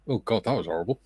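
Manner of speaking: ordinary talk, nothing marked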